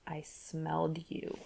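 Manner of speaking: slow hushed breath of a line. A narrator's reading. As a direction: breathe it close to her ear. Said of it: "he breathed".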